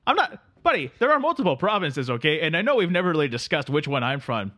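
sleepy Canadian drifter greeting his pal; Canadian accent. Acted. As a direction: play the neighbourly Canadian couch guest. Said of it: "I'm just a simple Canadian".

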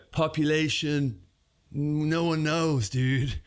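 very slow, drawn-out stoner punchline. Pretend. Stoner telling a visitor the town's population, stoner voice. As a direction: let each word trail along like a clueless stoner. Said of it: "do a really good stoner voice".

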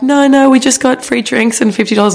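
high, insistent imitation; mock protesting friend. Friend refusing to come back to us, mock protest.

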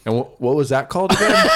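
fast, laughing through a keen question; suddenly keen student, laughing; laughing eager question. Retold or imitated.

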